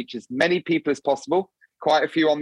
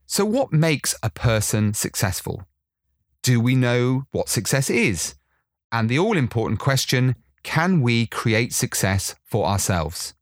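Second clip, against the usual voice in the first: podcast intro narration; 3 semitones lower than usual. Acted. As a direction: read it like a polished show opening. Produced podcast intro read.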